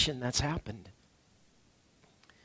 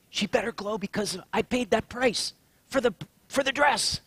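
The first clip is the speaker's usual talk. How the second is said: joking dad; 6 semitones higher than usual. Retold or imitated. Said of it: "you say".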